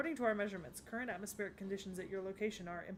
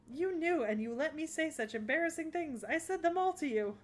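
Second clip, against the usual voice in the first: high, loud embarrassed outburst; mortified wailing; 6 semitones higher, 6 dB louder than usual. Acted. Goddess realises her embarrassing confessions were heard, high mortified wail. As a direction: wail in utter humiliation, stretching the last words.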